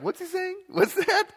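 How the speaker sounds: high, laughing mock confusion; squeaky puzzled bystander; confused Greek speaker. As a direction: play the baffled listener, amused. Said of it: "you're gonna be like".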